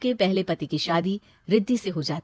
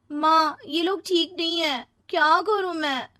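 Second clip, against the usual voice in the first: higher, pleading daughter to mother; distressed daughter; 9 semitones higher, 3 dB louder than usual. Acted. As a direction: beg your mother for help. (परेशान बेटी की फ़रियाद)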